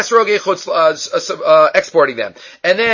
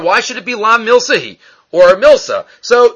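ordinary talk, nothing marked

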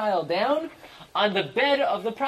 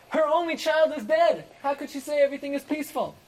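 incredulous; 7 semitones higher than usual. Real, not acted. Teacher exclaims in disbelief.

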